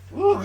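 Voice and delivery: very high "woo"; high whoop. Retold or imitated. Storyteller acting out the fight moment, high whoop. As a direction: whoop it high in mock excitement.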